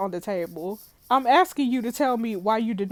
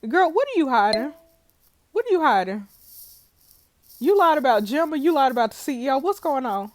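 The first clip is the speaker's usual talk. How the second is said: loud, high insistent questioning; pressing demand; 5 semitones higher, 6 dB louder, faster than usual. Acted. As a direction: push her hard for answers. Player pressing Bella hard.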